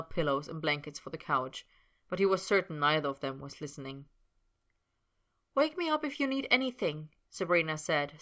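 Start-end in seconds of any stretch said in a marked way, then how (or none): 5.5-7.1 higher, smiling. A narrator's reading. Sabrina says goodnight to Potter, smiling. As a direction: say it with a smile. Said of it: "still smiling".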